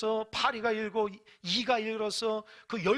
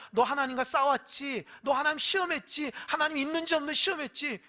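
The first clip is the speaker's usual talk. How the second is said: raised-pitch rebuke in a string of accusations; scolding; 5 semitones higher, 5 dB louder than usual. Acted. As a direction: accuse them point by point, voice pushed up. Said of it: "야단 맞으면서".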